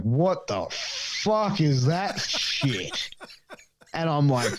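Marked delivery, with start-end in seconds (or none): 0.0-3.1 slow, drawn-out disgusted dad; gruff dad. Retold or imitated. Dad disgusted at the belt his son bought, slow and drawn out. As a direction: drag out the dad's disgust at the belt. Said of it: "My dad's like".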